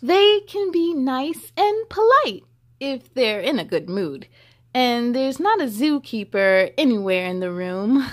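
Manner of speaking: put-on lively read-aloud to children; story reading. A narrator's reading. Reading the hippo book to children, lively storybook voice. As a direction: read it bright and sing-song for kids.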